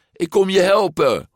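high, loud, eager call. A narrator's reading. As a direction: shout that help is coming. Friend rushing over calls out. (luid en hoog roepend)

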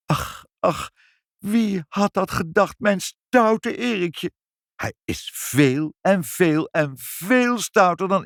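high, slow, drawn-out dismay; lamenting. A narrator's reading. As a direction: lament it word by stretched word. Mevrouw laments her naughty son. (hoog, langgerekt weeklagend)

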